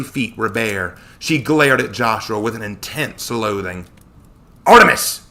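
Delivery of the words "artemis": high, loud, excited hiss; urgent hissed exclamation. A narrator's reading. Connor tells friend who has appeared, urgent hiss. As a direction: blurt the name out urgently. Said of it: "Connor hissed to me".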